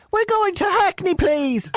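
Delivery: put-on very high, squeaky Irish accent; leprechaun Irish accent. [name] tells the Irish cab driver the destination, leprechaun Irish accent. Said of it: "in the most, leprechaun voice".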